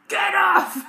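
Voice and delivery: high, loud, gruff little shout; gruff toddler shout. Retold or imitated. Toddler ordering someone away, gruff shout. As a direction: bark it like a tiny boss. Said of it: "in this really gruff voice".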